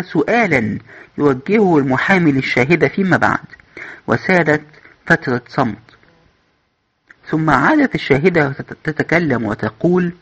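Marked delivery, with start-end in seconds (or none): none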